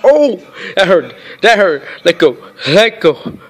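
loud yelps of pain; loud, urgent protest through pain; real pained yelling. Real, not acted. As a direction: cry out at the cat that is hurting you. Cat is biting the speaker.

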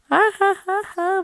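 taunting laugh. Acted. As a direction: rub it in. Brother gloats at sister. (ضحكة شماتة وتريقة)

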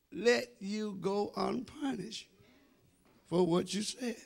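ordinary talk, nothing marked

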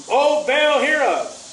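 high, loud plea to a god; calling out. Acted. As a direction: cry out in mock invocation. Acts out worshippers calling to Baal.

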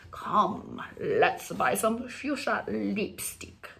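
slow, drawn-out fashionista; fashion darling. Acted. Channeling a fashion lover in Milan, affected darling voice. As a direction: savor each word like a fashion diva. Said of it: "Help me channel".

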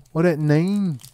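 high, loud exclamation; loud call. Pretend. Streamer repeats the pack question, loud and high. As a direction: belt it out high.

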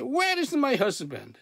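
high-pitched angry demand. Retold or imitated. Upset woman demands a husband from God, angry. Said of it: "so angry with God".

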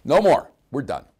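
a sudden loud enough-is-enough; firm outcry. Retold or imitated. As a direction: put your foot down. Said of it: "He says, Hey".

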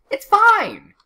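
high, very loud shout of exasperation; exasperated yell. Real, not acted. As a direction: yell it at the top of your voice. Exasperated at a healthy game dog.

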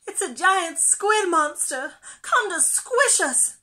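high, loud, panicky squeal; squeaking alarm. A narrator's reading. Pufferfish seeing a squid monster, high alarmed squeak. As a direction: squeak out a frightened warning. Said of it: "squeaked Pufferfish".

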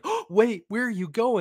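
gasp, then high pleading cry; mock alarm. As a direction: call after someone in exaggerated dismay. Acts out bar calling him back.

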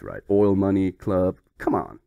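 ordinary delivery

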